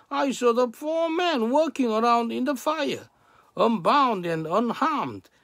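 high, loud proclamation; amazed proclamation. A narrator's reading. King reports the men walking in the fire, amazed proclamation.

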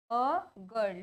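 "अ गर्ल" with the article as a short "uh"; article pronunciation demo. Shown as a demonstration. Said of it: "तो क्या बोलेंगे?".

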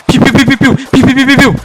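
playful laser-gun sound effects, loud and high; mock gunfire. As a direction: zap each germ with a silly pew sound. Shooting germs with a toy gun. (oyuncak silah sesi, neşeli ve yüksek)

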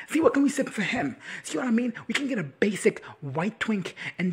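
high, loud, worked-up appeal; exasperated rant. Real, not acted. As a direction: pitch jumps up in real protest. Ranting about whom fans fawn over.

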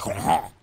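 "croissant" said low in the throat, like choking; mock French "croissant" with a gagging throaty r. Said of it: "say croissant like they're, like, choking on something".

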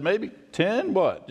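high, bargaining up the count; mock bargaining. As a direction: haggle over the number like a market deal. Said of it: "I'm the same way".

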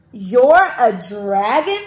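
loud, slow, incredulous question; mild storybook yell. A narrator's reading. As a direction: draw out the yelled question. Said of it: "yelled James".

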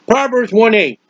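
ordinary delivery

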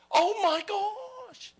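preacher crying out in wonder to the congregation; excited outcry. Real, not acted. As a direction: burst out in amazement. Preacher overwhelmed mid-sermon.

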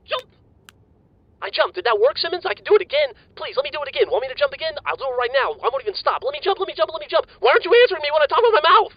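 bouncing off the walls, can't stop talking; hyper and overexcited. Acted. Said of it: "Calm down".

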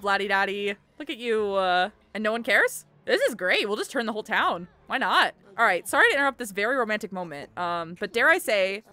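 ordinary talk, nothing marked

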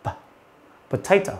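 "potato" with just a puff of air on the p; aspirated p only. Shown as a demonstration. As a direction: say "potato" as p'tato, no vowel after p. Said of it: "bật hơi của âm này thôi".

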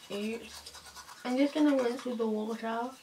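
talking around toothbrush; brushing teeth while speaking; toothbrush in mouth. Real, not acted. Seen 2: Toothbrush held in mouth throughout the labelled words.

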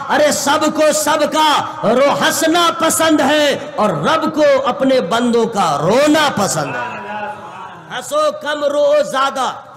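preaching shout. Acted. Preaching climax.

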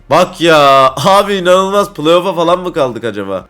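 loud, high exasperated outburst. Real, not acted. Gamer seeing the team slip to playoffs, exasperated. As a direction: protest in disbelief at bad results.

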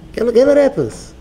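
high, insistent mock plea; acted begging. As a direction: play someone pestering for a handout. Acts out begging for money.

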